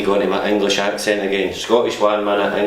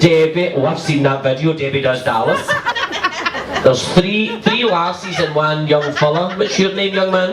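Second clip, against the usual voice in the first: lively crowd banter; 12 semitones higher than usual. Real, not acted. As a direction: work the room with a laugh. Comedian bantering with crowd, laughing.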